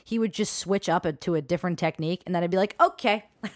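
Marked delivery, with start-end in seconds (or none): 2.8-3.2 higher, louder okay; acted reaction okay. Telling friends how she reacted to a switch, perked-up okay.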